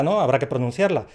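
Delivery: normal talking voice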